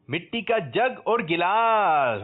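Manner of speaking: high, slow, stretched-out reveal; prize announcement. Real, not acted. Host presents the contestant's gift, showy announcement. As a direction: draw out each word to a big finish.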